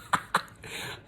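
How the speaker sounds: a short breathy laugh at himself. Real, not acted. Laughing at himself mid-rant to the camera. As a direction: laugh off his own tangent. Laughs at his own rambling.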